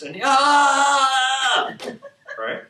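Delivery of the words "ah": loud, high drawn-out mock scream; sustained hammed-up scream; long imitated scream. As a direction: hold a long overwhelmed yell. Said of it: "He starts screaming".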